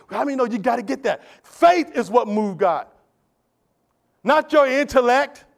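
normal talking voice

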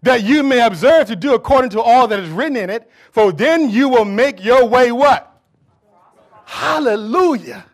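loud scripture proclamation with drawn-out words; full-force preaching. Acted. Preacher reading the promise to the congregation, full-force preaching. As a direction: ring out the promise, stretching key words.

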